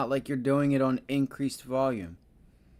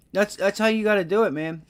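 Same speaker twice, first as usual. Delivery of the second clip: excited; 6 semitones higher, 4 dB louder than usual. Real, not acted. Streamer hypes a winning trade.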